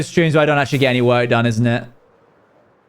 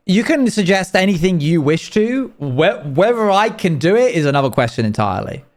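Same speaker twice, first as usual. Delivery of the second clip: ordinary talk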